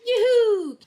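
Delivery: high whooping cheer, loud and bright. Real, not acted. Mom cheers after fixing the outlet, high whoops. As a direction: celebrate the small win out loud. Celebrating outlet cover finally on.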